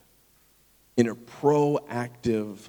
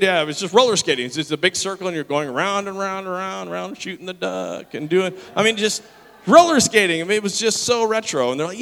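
normal talking voice

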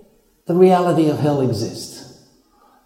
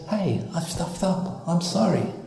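much softer, humble admission; quiet confession; 9 dB quieter than usual. Retold or imitated. Sinner owning up to God, soft and humble. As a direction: admit it gently. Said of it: "so hard today to say".

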